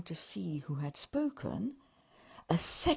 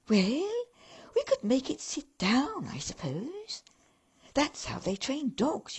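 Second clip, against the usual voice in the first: higher, louder musing elf; 7 semitones higher, 4 dB louder than usual. Acted. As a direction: elf thinking aloud. Elf suggests training.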